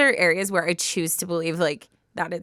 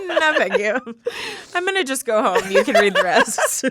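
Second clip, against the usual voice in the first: giggling, backing out of it; higher voice, laughing as you bow out; laughing refusal; 6 semitones higher, 4 dB louder than usual. Real, not acted. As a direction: laugh through a playful protest. Joking she will leave, laughing.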